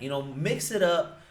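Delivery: usual speaking voice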